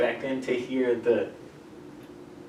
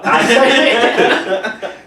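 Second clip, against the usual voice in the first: loud, high, drawn-out laughing; real laughter that breaks the sentence; 11 semitones higher, 12 dB louder, much slower than usual. Real, not acted. Telling friends the story, laughing hard.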